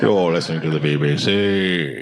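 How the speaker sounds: mock BBC announcer. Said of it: "I do listen to B B C News".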